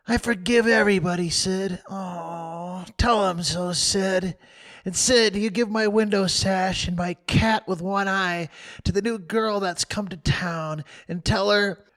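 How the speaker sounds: high, fast melodramatic farewell; mock deathbed speech. Tom bequeathing things to Sid, melodramatic. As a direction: hand out his treasures like a dying hero.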